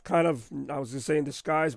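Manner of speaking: ordinary talk, nothing marked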